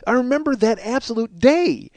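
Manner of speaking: high-pitched, marveling outburst; amazed recognition. Retold or imitated. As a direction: relive the rush of remembering, pitch soaring. Reliving a visceral memory charge.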